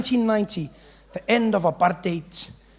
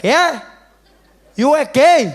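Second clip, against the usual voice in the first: put-on drunk uncle; 6 semitones higher, 10 dB louder, slower than usual. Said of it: "And he was like".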